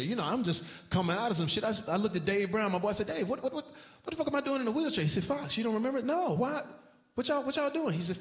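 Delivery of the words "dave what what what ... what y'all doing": panicked confusion. Retold or imitated. Waking confused in wheelchair.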